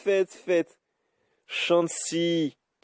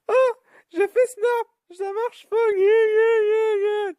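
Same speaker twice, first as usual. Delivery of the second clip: high, squealing mock-crying voice; mock crybaby whine; 5 dB louder than usual. Gamer whining at his failed move, high mock crying. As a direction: whimper it like a spoiled kid, way up high.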